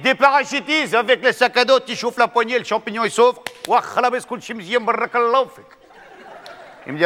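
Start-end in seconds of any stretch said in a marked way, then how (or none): 0.0-5.6 put-on higher, eager North African accent; excited accented answer. Mohamed eagerly answers the colonel, higher, North African accent.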